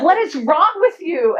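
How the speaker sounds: a parent losing patience; exasperated outburst. Retold or imitated. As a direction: let the frustration out at your son. Exasperated parent snaps at son.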